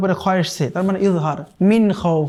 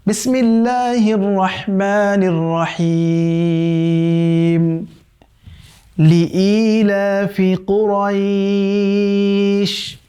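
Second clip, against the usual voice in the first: slow, drawn-out melodic tilawat; Quran recitation; much slower than usual. Shown as a demonstration. Teacher recites the verse for students in slow melodic chant. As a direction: chant the verse slowly with long held vowels. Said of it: "আল্লাহ ... বলছেন".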